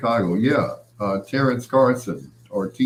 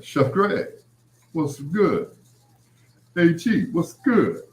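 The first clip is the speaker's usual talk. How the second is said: teasing impression; 7 semitones higher, slower than usual. Teasing Keith's greeting voice.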